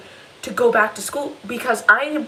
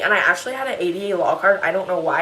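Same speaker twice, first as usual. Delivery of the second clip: ordinary talk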